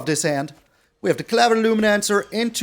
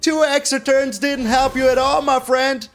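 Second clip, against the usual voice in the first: gloating; 5 semitones higher than usual. Real, not acted. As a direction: rub the win in their face. Gloating at defeated opponent.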